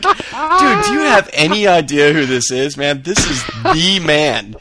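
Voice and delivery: high, loud, astonished outburst; retold incredulous shout; acted disbelief. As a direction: exclaim it as if they've snubbed a legend. Retells his own astonished reaction.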